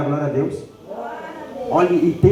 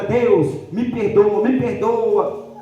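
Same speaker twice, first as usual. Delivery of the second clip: imitated tearful plea; 6 semitones higher than usual. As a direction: plead like a weeping penitent, sinking on the last word. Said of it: "Ela foi, chorou". (imitando uma súplica chorosa de perdão)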